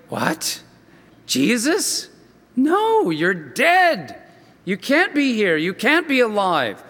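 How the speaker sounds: sudden high yelp of disbelief on No; stunned, rising pitch, refusing to believe it; shocked disbelief. Retold or imitated. Paul reacting to Jesus alive, shocked disbelief.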